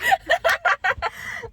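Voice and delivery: big squealing laugh; real laughter at her own outburst; loud high laugh. Real, not acted.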